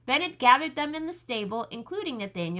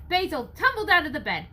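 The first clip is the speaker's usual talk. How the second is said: plain reading voice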